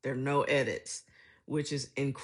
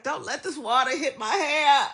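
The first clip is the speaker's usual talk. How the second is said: put-on high, loud, laughing plea of an old mother; mock mother's complaint; 11 semitones higher, 9 dB louder than usual. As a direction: squeal it like a fussy old mom. Retelling mother's complaint in shower.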